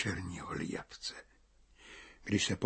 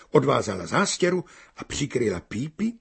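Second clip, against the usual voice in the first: ordinary delivery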